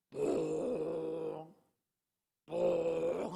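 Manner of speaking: long, low drawn-out honk; imitated horn call. Man in straw hat calling cows, long low horn call. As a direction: imitate a droning horn blown for cattle. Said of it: "뭐 하는 소리 있잖아요".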